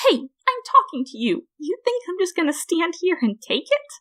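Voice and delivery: high, indignant flower voice; angry Flowey. A narrator's reading. Ignored flower snaps at the human, high and indignant. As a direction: demand attention, offended.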